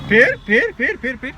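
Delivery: stern repeated telling-off; angry scolding. Real, not acted. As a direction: snap at the fighting pets. Scolding the fighting pets.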